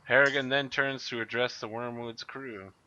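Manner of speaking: ordinary talk, nothing marked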